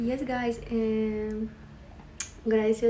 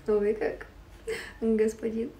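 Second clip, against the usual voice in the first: normal talking voice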